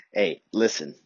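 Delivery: ordinary talk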